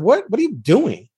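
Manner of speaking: ordinary talk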